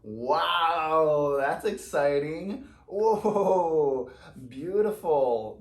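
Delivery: loud, high bursts of delight; thrilled. Real, not acted. Tarot reader thrilled by her spread, loud excited exclaims. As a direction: exclaim with real joy.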